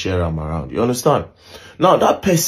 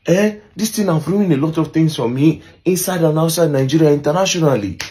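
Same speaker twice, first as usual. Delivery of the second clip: very high, worked-up retold complaint; emotional retelling; 9 semitones higher, 3 dB louder than usual. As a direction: imitate an upset friend arguing, much higher. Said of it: "David was really emotional".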